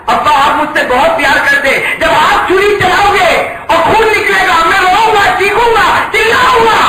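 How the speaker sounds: a son begging his father, swelling into a shout; building plea. Acted. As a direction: build from pleading to a full cry. Son pleads with rising passion.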